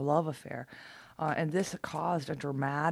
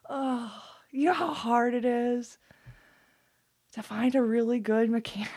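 higher and louder, gushing with delight; giddy; 7 semitones higher, 6 dB louder than usual. Real, not acted. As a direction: rave to viewers about finding a good mechanic, giddy. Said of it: "I'm sorry, I'm giddy".

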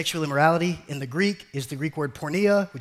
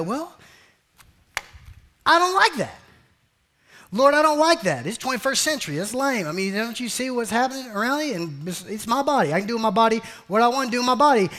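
higher, louder, pleading complaint; mock whiny believer; 7 semitones higher, 5 dB louder than usual. Preacher mimics a believer whining to God. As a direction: play the sulky churchgoer arguing with God.

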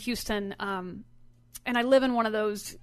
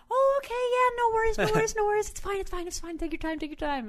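much higher, chirpy, eager to please; a flustered nice lady reassuring; sweet neighbor; 10 semitones higher, faster than usual. Retold or imitated. Innocent neighbour reassuring the angry driver, high chirpy voice. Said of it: "And she goes".

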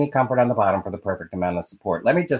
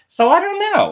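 squeaky I don't know; 7 dB louder than usual. Real, not acted. Shrugging uncertainty.

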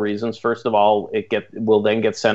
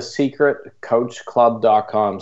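slow, careful; much slower than usual. Real, not acted. Giving listeners the website despite a Canadian accent, slow and careful. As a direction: spell out the web address slowly. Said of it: "say that out very slowly".

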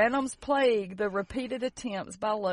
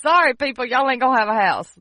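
mock official; 2 semitones higher, 10 dB louder, faster than usual.

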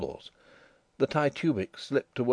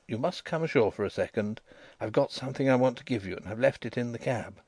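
plain reading voice

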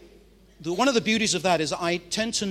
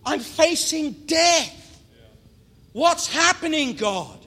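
loud, much higher, anguished wail; desperate cry to God; 10 semitones higher, 10 dB louder than usual. Pretend. David facing death, crying loudly to God. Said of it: "Do you think he said that like that?".

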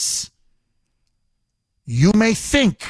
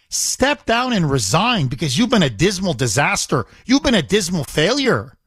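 ordinary talk, nothing marked

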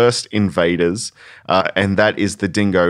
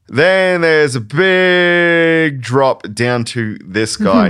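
slow, rising build to a high, loud payoff on guy; drawn-out reveal; 7 semitones higher, 4 dB louder, much slower than usual. Acted. Host teasing the next animal to co-host, slow dramatic build. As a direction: stretch it out like a game-show reveal.

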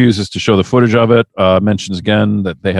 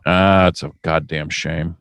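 quiet, low-pitched regret; low lament; 7 semitones lower, 5 dB quieter than usual. Acted. Co-host reacts to training injuries, low lament. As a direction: say it low and subdued.

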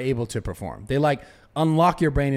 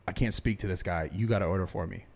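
low, subdued voice, much softer; hushed plea; 6 semitones lower, 7 dB quieter than usual. Retold or imitated. Tripping customer asks friend to order for him, hushed. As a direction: quietly beg a friend to take over.

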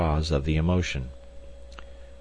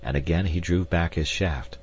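ordinary delivery, nothing marked

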